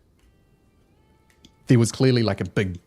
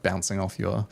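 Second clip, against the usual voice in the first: usual speaking voice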